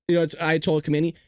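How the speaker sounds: ordinary delivery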